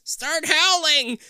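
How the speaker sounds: slow, high, loud panicked shout. A narrator's reading. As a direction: scream the order in panic. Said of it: "Todd shouts".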